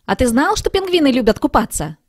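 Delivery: bubbling over with fun facts; eager question. A narrator's reading. Eager kitten asks friend. (взволнованно, выше и громче)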